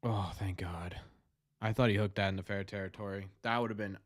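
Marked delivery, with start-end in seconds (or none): 0.0-1.0 relieved whisper. Real, not acted. Tense pitch nearly hit fair.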